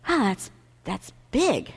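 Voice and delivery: soft, slow, awestruck reaction; retold awe. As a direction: let it land slowly, hushed. Retelling own awed reaction.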